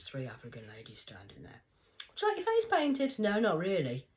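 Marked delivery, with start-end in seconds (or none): 2.1-3.2 very high, loud, sing-song offer; friendly shop lady. Retold or imitated. A speaker voicing a shop lady, very high and loud. 3.2-4.0 louder, higher, dismissive no; curt refusal. Retold or imitated. A speaker refusing face paint, loud and dismissive.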